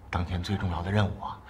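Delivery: usual speaking voice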